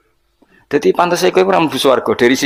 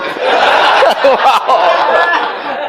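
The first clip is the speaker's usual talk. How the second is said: long laugh; 13 semitones higher than usual. Real, not acted. Laughing at own joke.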